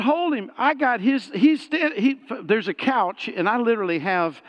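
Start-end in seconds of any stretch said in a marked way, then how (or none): 0.0-0.5 high, disbelieving repeat of hold him; incredulous echo. Retold or imitated. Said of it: "I went".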